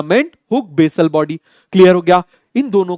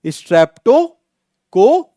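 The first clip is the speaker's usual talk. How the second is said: drawn-out prompt; 5 semitones higher, much slower than usual. Shown as a demonstration. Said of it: "जल्दी से आप मुझे बताओ".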